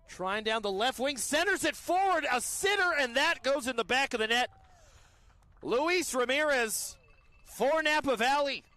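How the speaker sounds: loud, high play-by-play building to the goal; big live soccer goal announcement; excited goal call. Real, not acted. Commentator calls a goal live.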